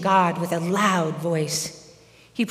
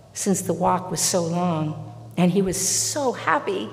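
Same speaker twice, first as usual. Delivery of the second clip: usual speaking voice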